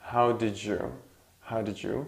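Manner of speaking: did you blended into didja, softly. Shown as a demonstration. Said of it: "we have d and yeah, it becomes j".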